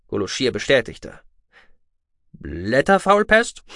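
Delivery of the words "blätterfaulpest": slow, high, loudly rising question; disgusted disbelief. A narrator's reading. Officer wrinkling his nose at the plague, disgusted disbelief. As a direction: draw it out, incredulous and repelled. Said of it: "Der Akonide rümpfte die Nase".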